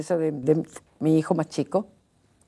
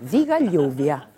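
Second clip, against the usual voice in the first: laughing retort; 3 dB louder, slower than usual. Retold or imitated. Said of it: "Entonces rápidamente le digo".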